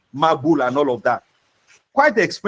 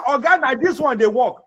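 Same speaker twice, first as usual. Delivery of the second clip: rude complaint; 6 semitones higher than usual. Retold or imitated. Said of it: "You say".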